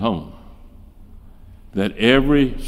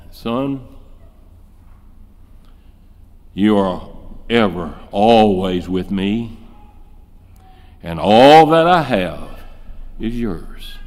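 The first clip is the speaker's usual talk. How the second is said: compassionate father; 4 semitones lower than usual. Acted. Father gently reassuring his jealous older son, lightly voiced. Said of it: "can't you hear him? The compassion just dripping from his heart".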